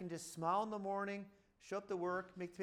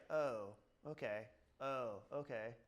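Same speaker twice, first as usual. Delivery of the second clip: slow, lower, deflated "oh, okay"; deflated resignation; 7 semitones lower, slower than usual. Retold or imitated. Owner seeing the bills, slow deflated resignation. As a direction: sink into it as the bills land. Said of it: "you're like".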